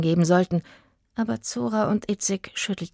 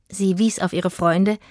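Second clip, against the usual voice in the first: ordinary delivery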